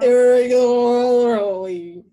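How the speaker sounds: IRREGULARLY very loud and drawn out, consonants smeared; mocked slurred speech. Teasing co-host's voice right now, exaggerated slurred IRREGULARLY. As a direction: garble IRREGULARLY into long rolling R and L sounds. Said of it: "Your voice right now".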